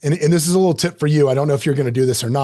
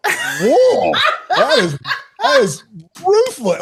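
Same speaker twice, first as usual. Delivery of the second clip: laughing whoa climbing high; incredulous laughing whoa; laughing disbelief; 8 semitones higher, 3 dB louder, much slower than usual. Retold or imitated. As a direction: laugh it off, stunned at the insult. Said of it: "I was like".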